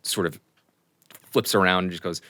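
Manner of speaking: ordinary delivery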